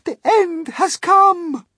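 very high, slow, anguished wail; despairing cry. Acted. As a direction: cry out as if all is over. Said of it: "Miss Spider cried".

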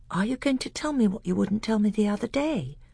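lightly voiced eager boy; a hint of a keen young prince.

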